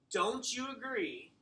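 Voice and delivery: slow, with you stressed and falling; emphasis on you. Shown as a demonstration. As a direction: lean on you in don't you agree. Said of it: "putting that emphasis on it".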